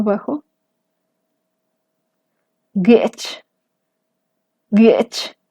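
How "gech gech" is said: gech with a creaky glottal catch; glottalized gech. Shown as a demonstration. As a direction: tighten the throat on gech. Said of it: "Vamos a ver otro ejemplo".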